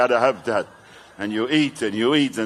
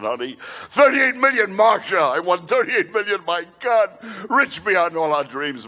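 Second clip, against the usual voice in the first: much higher, thrilled mock shout; ecstatic husband; 6 semitones higher than usual. Husband bursting home to tell Marsha of the win, thrilled. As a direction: burst in like you just hit the jackpot.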